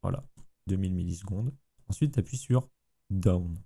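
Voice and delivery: usual speaking voice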